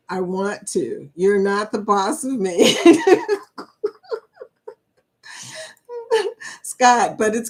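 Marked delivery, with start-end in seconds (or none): 2.8-4.2 high, loud real laugh; a big happy laugh. Real, not acted. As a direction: crack up at the chat. Laughing at chat joke.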